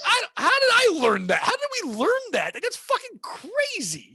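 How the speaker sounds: high-pitched, incredulous questioning; amazed disbelief. Acted. Man baffled by how children learn to talk, high-pitched amazement. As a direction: marvel at it, pitch leaping way up.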